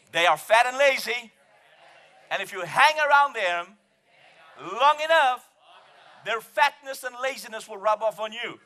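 loud, high, phrase by phrase; ringing pulpit proclamation; call-and-repeat declaration. Real, not acted. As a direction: lead the congregation to repeat it boldly. Preaches phrases for congregation to repeat.

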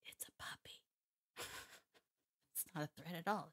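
half-whispered, as if obvious; hushed obviousness. Real, not acted. Trainer explaining why she turns her back, hushed. Stating the obvious about puppy.

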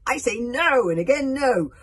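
full, high, very loud shout; emphatic roar of refusal. A narrator's reading. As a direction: thunder it as a tyrant. Villain king's defiant proclamation.

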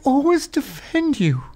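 put-on high, slow, wounded drunk voice; mocking a wounded drunk woman's complaint; crushed drunk woman. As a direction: whine like a hurt drunk. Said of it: "she's instantly crushed".

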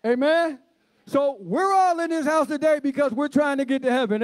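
high-pitched, ringing scripture proclamation; fervent preaching. Real, not acted. Preacher reads Revelation's promise to church, fervent preaching.

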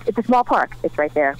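ordinary delivery

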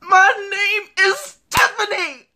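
loud. Acted. Insists on her name louder.

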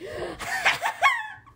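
high, loud maniacal cackle; psycho laugh. Real, not acted. Player cackling like a psycho after dying. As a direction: laugh like a madwoman. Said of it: "I sound like a psycho now".

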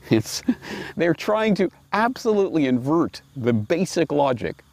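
ordinary delivery

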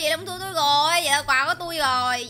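ordinary delivery

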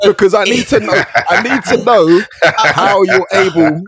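high, loud, words stretched through laughter; real laughter pushing the voice up; laughing demand. Real, not acted. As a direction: insist on an answer while cracking up. Teasing question through shared laughter.

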